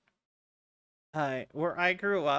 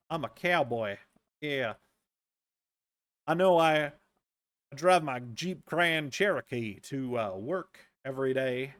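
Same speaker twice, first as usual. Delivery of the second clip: fake cowboy accent; 4 semitones lower than usual. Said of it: "cowboy accent and they'll".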